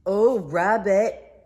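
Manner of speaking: slow loud call from afar. Acted. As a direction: call out to Rabbit. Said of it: "Pooh called from outside".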